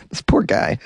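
usual speaking voice

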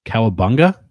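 a mild imitation punching cow in "Cowabunga?"; stress on cow. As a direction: lean hard on the first syllable, "Cowabunga?". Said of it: "with the accent on cow".

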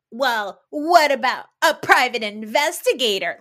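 mimicking Whitney. Mocking Whitney's suggestion to Meredith, silly voice. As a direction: parrot her clueless suggestion.

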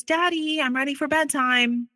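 put-on high-pitched little boy calling to daddy; singled-out high child voice, retold; young son. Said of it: "Our son walks downstairs".

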